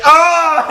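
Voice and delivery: high, loud yelp when struck; hammed-up ouch; mock cry of pain. Sandman hit from behind, a loud high yelp.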